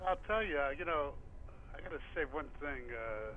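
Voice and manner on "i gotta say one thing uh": quiet, distant phone voice; phone held away. Real, not acted. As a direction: speak off the mouthpiece. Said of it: "We can't hear you".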